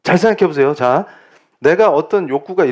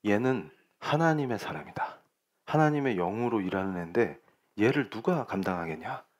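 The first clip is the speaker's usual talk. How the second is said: Pharaoh; 5 semitones lower, 10 dB quieter than usual. Retold or imitated. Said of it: "바로가 뭐라 그래요".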